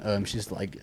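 ordinary delivery

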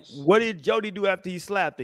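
ordinary delivery

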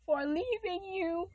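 high, loud anguished plea to God, then a slow drop; a repentant daughter begging forgiveness; pleading apology. Retold or imitated. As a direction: cry out sorry to God, then trail off slowly. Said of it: "when I turn back to him and say".

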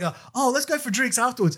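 high-pitched mock enthusiasm; eager invitation. Other comedians proposing drinks after the show, imitated eager high voice.

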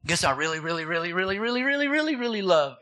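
rapid-fire playful build on repeated "really"; ramped up to ten. Retold or imitated. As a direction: pitch climbs on each "really", then drops. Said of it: "I'll get, like, to number ten".

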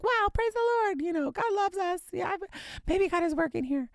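high, bright-eyed praise, pitched well up; high, thrilled thanks to God; hopeful excitement. Retold or imitated. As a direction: gush with hope that it's finally happening. Couple retelling their hopeful excitement.

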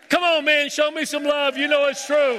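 high, loud mock husband rant, shouting; he lists every chore he's quitting in a high shouted rant; fed-up husband rant. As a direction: play the husband who's done with chores, hammed up. Husband pleads for love, mock rant.

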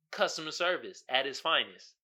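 ordinary talk, nothing marked

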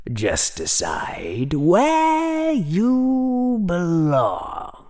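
high, very slow, sing-song hat voice; slow, otherworldly character voice, long vowels; calm Sorting Hat. A narrator's reading. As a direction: stretch each word out high and calm. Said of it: "said the calm voice of the Sorting Hat".

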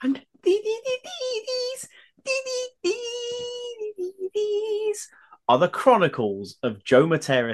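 hoarse, straining for a high intro; croaky voice pushed up high. Real, not acted. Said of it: "the bearded brummie with a very croaky voice".

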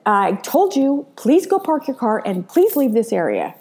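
high, sharp, impatient repeat of "please go park your car"; snapping nurse. Retold or imitated. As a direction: snap it like a nurse who's had enough. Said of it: "sort of snapped at me".

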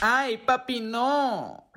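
high-pitched theatrical moan of protest; drawn-out high squeal, playing at web sex; mock web sex. Mock web sex during soundcheck.

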